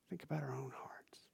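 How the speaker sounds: hushed inward whisper. Real, not acted. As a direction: lean in and whisper it. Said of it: "let's think about our own hearts in that way too".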